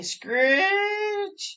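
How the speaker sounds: high shrill screech of brakes; piercing brake screech. Acted. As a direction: squeal it out like train brakes.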